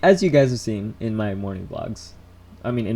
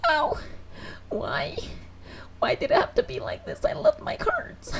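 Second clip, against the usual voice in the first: put-on very high, squeaky child's sobbing wail; hammed-up whimpering, thin and high; mock crying; 4 dB quieter than usual. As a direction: act out a heartbroken kid crying. Reenacts devastated child crying over cards.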